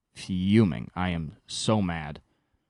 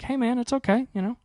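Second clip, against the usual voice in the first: imitating girlfriend comforting; 9 semitones higher than usual. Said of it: "she's given all the good advice of like".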